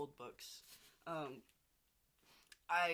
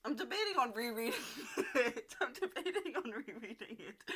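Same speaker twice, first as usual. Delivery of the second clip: laughing; 4 semitones higher, 3 dB louder than usual. Real, not acted. Laughing while saying it.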